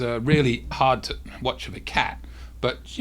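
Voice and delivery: ordinary delivery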